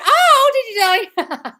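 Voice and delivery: very high, loud, slow squeal; gushing delight. Real, not acted. Host hearing viewer dreamed of her, gushing. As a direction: stretch the oh into a delighted swoop.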